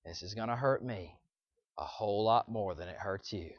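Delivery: low, soft imitation of a parent; parent before a spanking. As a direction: lightly voice a solemn parent, low and quiet. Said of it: "mom or dad always saying".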